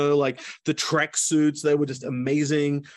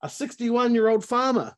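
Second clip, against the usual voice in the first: higher, scoffing imitation of the racers; mocking disbelief; 7 semitones higher than usual. Young racers scoff at the old farmer entrant, mocking. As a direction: sneer it like a cocky young athlete. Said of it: "all the cool kids... they're like".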